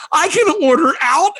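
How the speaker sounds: high-pitched revelation; amazed realization. Retold or imitated. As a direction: jump up high as if just discovering it. Acted sudden revelation about ordering food.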